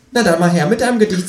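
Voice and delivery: high, quick kangaroo voice; high-voiced, hurried kangaroo; kangaroo character. A narrator's reading. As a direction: fire it off as the kangaroo, pitched high. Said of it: "sagt das Känguru".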